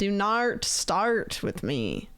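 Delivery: very slow mock-Australian with stretched vowels; exaggerated Australian accent. Streamer mimicking friend who objects, exaggerated Australian accent. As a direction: overdo the Australian, word by word. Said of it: "It's not how I say it".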